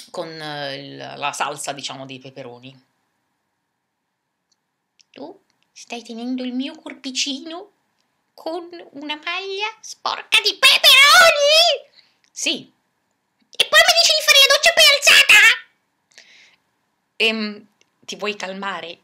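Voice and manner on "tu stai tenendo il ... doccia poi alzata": outraged puppet. Acted. Giraffe puppet scolds Zupla over the stained shirt, high and outraged. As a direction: shriek in offended disbelief.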